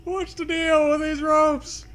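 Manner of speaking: mock observational-comic delivery; stand-up comic riff. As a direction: do it as a stand-up bit. Comedian-style bit.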